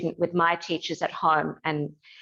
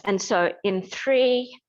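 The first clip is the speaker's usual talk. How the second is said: guided meditation. Acted. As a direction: guide them gently into stillness. Leads a gentle standing meditation.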